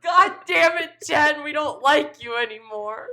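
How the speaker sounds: high, loud, playful outburst; mock angry shout. Co-host jokingly blames manager Jen, mock angry shouting. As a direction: yell a pretend rejection.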